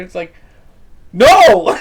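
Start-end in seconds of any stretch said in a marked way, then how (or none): 1.1-1.8 sudden loud outburst; shouted no. Real, not acted. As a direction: blurt out a loud no. Reacting to a staged interview.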